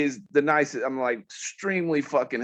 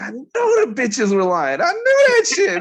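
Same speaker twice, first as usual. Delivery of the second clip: triumphant outburst; 12 semitones higher, 6 dB louder than usual. Retold or imitated. Vindicated rant retold.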